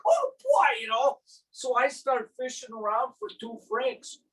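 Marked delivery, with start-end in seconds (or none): 0.1-0.7 put-on high, excited "Oh boy"; mock delighted. Husband thrilled by the fancy toilet, high excited squeal. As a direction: squeal it with glee. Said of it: "I'm like".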